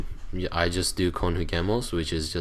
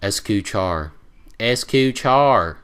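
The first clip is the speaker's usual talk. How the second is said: mimicking students' American-accented Spanish, drawn out, getting louder; 4 semitones higher, 4 dB louder, slower than usual. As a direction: say "Escuchar" with a heavy American accent. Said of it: "say it with an American accent".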